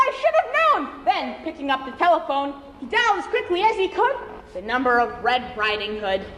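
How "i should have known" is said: high, outraged pig voice; crying piglet. Acted. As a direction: squeal the insult high. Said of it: "pig cried".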